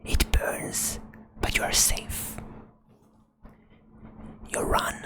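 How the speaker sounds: ordinary delivery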